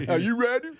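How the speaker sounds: goofy put-on voice. Goofy voice game.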